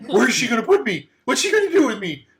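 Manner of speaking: high, pleading protest; panicked bust. Acted. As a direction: beg not to be sold.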